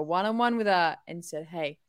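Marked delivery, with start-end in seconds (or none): none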